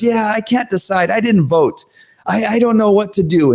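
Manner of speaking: lightly voiced waffling voter; mock waffling. Parent mimicking the undecided board member, light dithering. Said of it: "Marilyn says".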